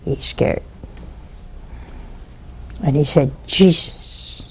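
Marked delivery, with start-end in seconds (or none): 0.1-0.6 hushed whisper, much softer. Shown as a demonstration. Repeating that the dead man is scared, in a whisper. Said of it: "Now he's scared". 3.5-4.4 breathless whispered Jesus; whispered plea. Retold or imitated. The frightened dead man begs Jesus to save him, whispered.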